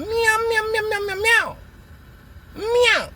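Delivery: high mocking cat meows; mock kitty meows. Mocking the enemies who now meow like kittens, high and teasing. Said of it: "they meowing like little kitty cats".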